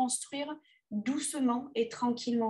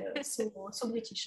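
English accent; 7 dB quieter than usual. Shown as a demonstration. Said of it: "avec mon super accent anglais".

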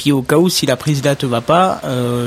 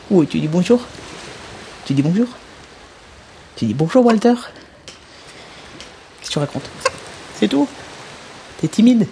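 talking to a parrot; 5 semitones higher, 4 dB quieter than usual. Acted. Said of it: "faire parler mon petit Robin".

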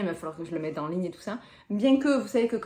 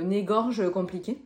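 ordinary talk, nothing marked

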